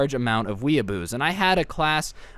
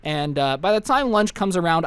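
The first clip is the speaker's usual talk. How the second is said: ordinary talk, nothing marked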